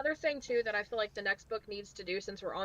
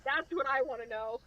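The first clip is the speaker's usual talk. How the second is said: normal talking voice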